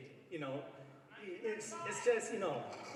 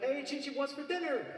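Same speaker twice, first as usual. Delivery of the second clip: cheery Goku; 9 semitones higher than usual. Retold or imitated. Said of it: "Goku's like".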